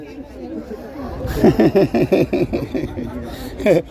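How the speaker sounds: a long real laugh after the walk-off; laughing it off after ending the interview. Real, not acted. Laughing as the interviewee walks away.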